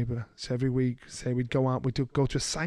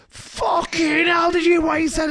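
put-on excited kids shouting; 13 semitones higher, 6 dB louder than usual. Kids on the bus home after prison, excited shout. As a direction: burst out in amazed disbelief.

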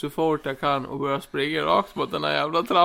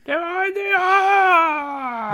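very slow, very high, loud wail; wailing denial; 5 dB louder, much slower than usual. Acted. Suspect wails his innocence at the cop, very slow and high. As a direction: wail it out in despair.

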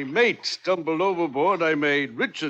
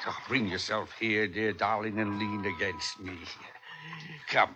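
much softer, lower sweet-talk to his wife; soft coaxing; 8 semitones lower, 9 dB quieter than usual. Acted. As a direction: murmur it to draw her in. Captain coaxing his wife close.

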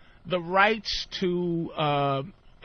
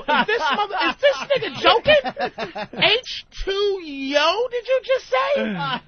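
much higher, slowed incredulous squeal; incredulous disbelief; 14 semitones higher, 3 dB louder, slower than usual. Retold or imitated. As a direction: ask the room if he's really joking, high and stunned. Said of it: "somebody else to look at to go".

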